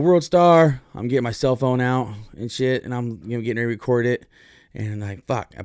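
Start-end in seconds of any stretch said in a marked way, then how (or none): 0.0-0.8 high, loud shout; excited call-out. Retold or imitated. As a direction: yell it out as the fight starts. Calling out at a fight.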